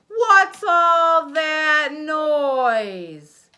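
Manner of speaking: slow, loud, high grumpy bear roar; cranky bear shouting slowly at the noise; grumpy bear bellow. Acted.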